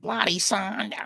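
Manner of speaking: high, whiny mock-singing; mocking high whine, drawn out; Jay's whiny hook.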